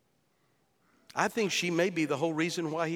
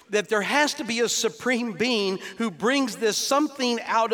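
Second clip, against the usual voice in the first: louder, higher fervent sermon voice; preaching at full force; 5 semitones higher, 6 dB louder than usual. Acted. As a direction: drive the point home from the pulpit.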